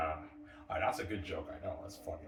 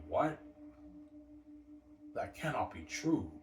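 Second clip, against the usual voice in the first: retold crowd's disbelief, higher and drawn out; shocked disbelief; 6 semitones higher, slower than usual. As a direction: act out a stunned "What?", then slow down. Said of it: "They're shocked, obviously".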